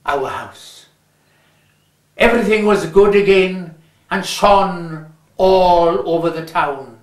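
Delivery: ordinary delivery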